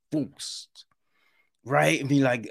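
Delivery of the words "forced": whispered. Real, not acted. Whispered critical word.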